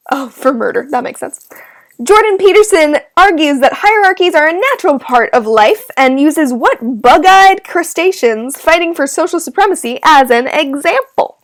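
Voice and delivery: usual speaking voice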